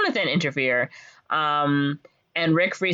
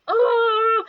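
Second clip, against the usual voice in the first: high drawn-out mock moan; hammed-up helpless cry; doomed wail; 4 dB louder than usual. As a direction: wail it like cartoon despair. Voicing trapped Benny's wail.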